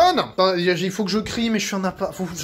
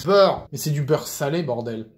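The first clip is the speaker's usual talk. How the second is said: usual speaking voice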